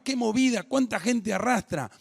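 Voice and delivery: high, impressed onlooker voice; a light touch of an astonished passerby; voicing onlookers amazed at the crowd around Jesus; amazed bystander.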